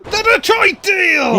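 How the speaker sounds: big hype call of the move; excited shout. Real, not acted. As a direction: yell the move name with full hype. Commentator calls finishing move.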